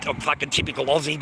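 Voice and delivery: ordinary talk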